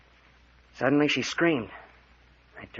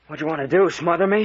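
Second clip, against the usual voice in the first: angry outburst; 5 semitones higher, 6 dB louder than usual. Acted. Parker choking, angry at Mayor.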